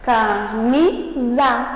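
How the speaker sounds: "Camisa" silabado devagar, ca-MI-sa, força no "mi"; sílaba tônica destacada. Shown as a demonstration. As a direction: separe "Camisa" em sílabas, com pausas. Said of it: "eu falei com mais força". ("Camisa" bem pausado, sílaba por sílaba)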